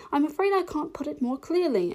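polite little heroine. A narrator's reading. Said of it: "Alice replied very politely".

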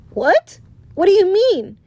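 high, loud, puzzled disbelief; retold surprise. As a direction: act out being caught totally off guard. Retells own reaction to surprise news.

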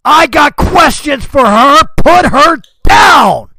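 full-force high shout at a dragon; very loud, high-pitched yell; screaming. Acted. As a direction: scream a furious demand across the battlefield. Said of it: "Farron screams at the dragon".

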